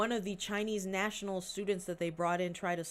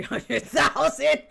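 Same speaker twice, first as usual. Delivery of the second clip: laughing; 9 semitones higher, 14 dB louder, much slower than usual. Retold or imitated. Laughing at own punchline.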